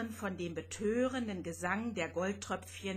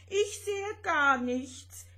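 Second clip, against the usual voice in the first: whining; 8 semitones higher, 5 dB louder, slower than usual. Acted. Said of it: "jammerte Rosetta".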